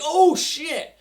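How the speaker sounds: imagined villain caught off guard, mock alarm. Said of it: "in my head I was picturing".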